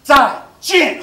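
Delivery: mock-angry Mandarin goodbye Zai Jin; harsh angry goodbye. Shown as a demonstration. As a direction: spit out Zai Jin like a furious farewell. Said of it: "harsh, angry goodbye".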